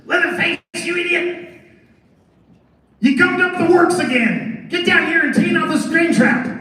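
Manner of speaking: high-pitched, irate screech in character; Chop Top screaming. Acted. Angry brother berating Leatherface over clogged works, screaming. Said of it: "gonna be screaming at his brother Leatherface".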